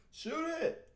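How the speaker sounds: high-pitched yelled coaching; coach yelling. Retold or imitated. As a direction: bark out orders like a courtside coach.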